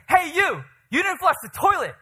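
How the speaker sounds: loud, high acted yell; shouted accusation. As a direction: call him out across the yard.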